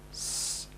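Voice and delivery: "s" hissed alone, unvoiced; isolated S. Shown as a demonstration. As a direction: hold a clean "s" apart from "ah". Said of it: "And another one".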